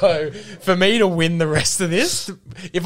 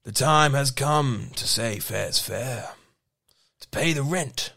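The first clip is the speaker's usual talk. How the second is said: slow country Australian with English twang; measured, low Aussie drawl with a British hint; Mildura country accent; 4 semitones lower, slower than usual. Acted. Reading lyrics as a middle-aged Mildura local, country Australian accent. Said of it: "I can't stop this voice".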